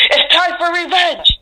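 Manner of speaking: high, slow drawn-out yell in a put-on voice; revenge cry. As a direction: belt it out like a hero's vow. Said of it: "and he says".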